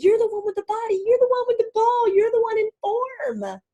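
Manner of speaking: very high, loud hammed-up shouting; crowd cheering on. Fans urging on the player with the ball, shouting. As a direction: yell encouragement from the stands, acting exasperated fans.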